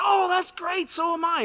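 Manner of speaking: high, loud delighted cry; overjoyed. Retold or imitated. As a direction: squeal with delight at the match. Overjoyed man in joke.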